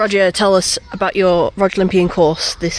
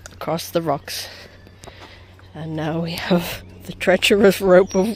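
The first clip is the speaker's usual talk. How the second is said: hushed sportscaster play-by-play; sports commentary; 4 semitones lower, 3 dB quieter than usual. Acted. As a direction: narrate it like a golf commentator. Mock sports commentary of an obstacle run.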